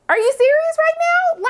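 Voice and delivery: very high-pitched disbelief; incredulous. Acted. Reacting to the captor asking for baby care, incredulous. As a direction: squeal it in affronted disbelief.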